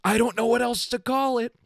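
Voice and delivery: imitating a whiny boyfriend; mocking boyfriend. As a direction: parody the boyfriend as petulant. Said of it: "he even said".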